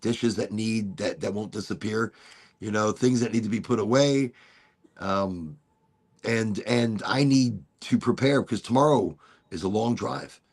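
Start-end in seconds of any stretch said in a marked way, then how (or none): none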